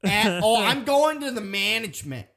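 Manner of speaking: put-on angry local with a Boston accent. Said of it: "pretty good Boston".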